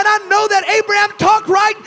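loud, rising preacher's cadence, slow on key lines; preaching at full force. Acted. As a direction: drive each line harder to the crowd. Preaching to congregation.

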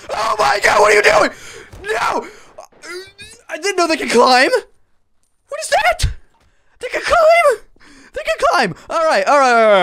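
high, loud frantic yells; panicked shrieking. Real, not acted. Gamer yelling as monsters climb his roof, panicked. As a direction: shriek in disbelief as they climb up.

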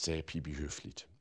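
ordinary delivery

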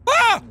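short high loud yelp of fright; startled scream. Real, not acted. Player frightened by a sudden bird, high scream. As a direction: scream at the jump scare.

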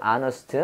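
"honest" with the h silent, starting on a vowel. Shown as a demonstration. Teacher correcting honey to "honest", h left silent. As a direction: say "honest" as "AH-nust", no h sound. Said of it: "발음 honey가 아니라 honest예요".

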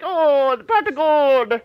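high, loud, slow mock Irish cheer; hammed-up Irish leprechaun voice; mock Irish accent. Joking how nobody minds Irish jokes, mock Irish accent. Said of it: "And the Irish".

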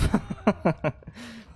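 high rattling giggle; amused laugh. Real, not acted. Player laughs at what would've pissed them off, amused. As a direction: laugh in a quick, high run.

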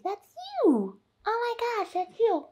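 high, delighted cooing. Real, not acted. Baby talk at the mirror.

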